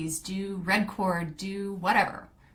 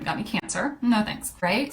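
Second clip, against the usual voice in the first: normal talking voice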